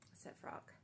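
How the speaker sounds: usual speaking voice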